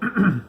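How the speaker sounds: a showy throat clear. A narrator's reading. Bear preparing to tell his story, showy throat clear. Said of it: "cleared his throat".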